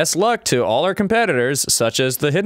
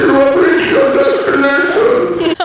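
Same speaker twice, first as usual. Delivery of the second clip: very high, loud hammed-up moaning robot; mock an AI car groaning on the drive; terrible robot voice; 8 dB louder, much slower than usual. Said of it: "It's so terrible".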